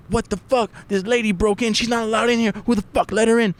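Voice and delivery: high, indignant rant; imitating an outraged neighbor. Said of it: "He's like".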